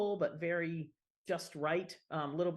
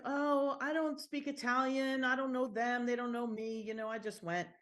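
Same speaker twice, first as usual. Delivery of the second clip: mimicked excuses; 6 semitones higher than usual. Italian Americans excusing skipping the home village, mock-sheepish. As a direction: imitate reluctant travellers, pitched up and easing down.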